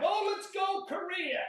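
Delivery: shouted call. Real, not acted. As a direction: announce the switch to Korean counting. Coach switches counting to Korean.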